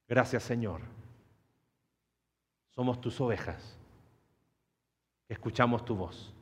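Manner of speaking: soft, low voice with long pauses; hushed, unhurried pastoral appeal, falling at each phrase end; prayerful reflection. Real, not acted. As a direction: gently lead listeners into prayer.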